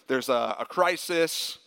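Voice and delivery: ordinary delivery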